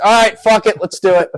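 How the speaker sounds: loud, high psych-up; reckless resolve. Retold or imitated. Podcaster psyching himself up for the coach interview, reckless resolve.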